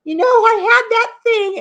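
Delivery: high, put-on voice of mom; high imitation of a dotty mother; mom's voice. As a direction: mimic her mother's airy dodge. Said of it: "she's like".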